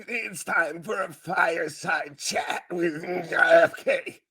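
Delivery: slow, strangled politician impression; mock candidate voice. Co-host as the candidate addressing the nation as president, mock strained voice. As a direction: labor through it in a croaky put-on voice. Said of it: "What, what if he addresses the nation as president?".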